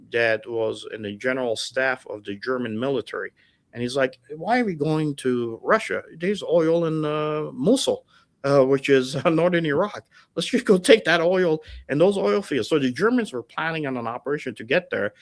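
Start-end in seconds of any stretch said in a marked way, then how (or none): 4.4-12.7 higher-pitched, puzzled argument; raised voice, mock obvious-solution tone; retold urging. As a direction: act out an officer pitching a plan. Armenian officer's argument retold.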